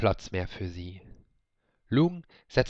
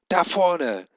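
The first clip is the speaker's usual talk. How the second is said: laut und hoch gebrüllt; brüllen; 8 semitones higher, 6 dB louder than usual. A narrator's reading. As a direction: gegen den Sturm anbrüllen. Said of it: "brüllte Ben". (Ben brüllt dem Drachen über das Meer zu)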